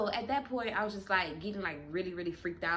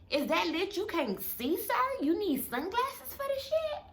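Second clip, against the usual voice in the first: much higher, disbelieving question; high, sarcastic disbelief; incredulous; 9 semitones higher than usual. Retold or imitated. Mocking sunglasses at night.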